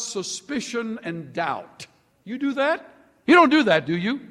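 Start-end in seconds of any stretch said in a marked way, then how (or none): 2.2-4.2 teasing. Acted. Teasing the congregation.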